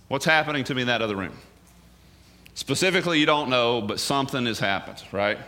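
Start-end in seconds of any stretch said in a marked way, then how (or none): none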